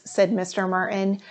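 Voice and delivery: plain reading voice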